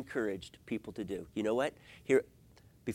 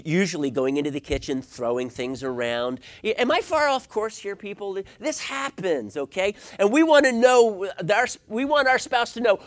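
usual speaking voice

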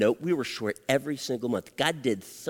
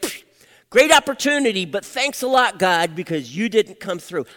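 loud, high sarcastic thanks; angry sarcasm; 6 semitones higher, 7 dB louder than usual. Retold or imitated. Let-down father complaining to God, angry and sarcastic. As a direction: throw the thanks back bitterly and loudly. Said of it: "I was angry".

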